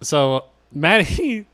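normal talking voice

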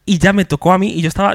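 usual speaking voice

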